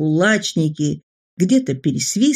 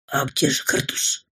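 softer, breathless whisper of sudden worry; frightened whisper; 4 semitones lower, 6 dB quieter than usual. Acted. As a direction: whisper it as dread dawns. Frightened boy thinks to himself.

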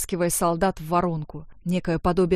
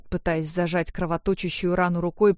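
ordinary delivery, nothing marked

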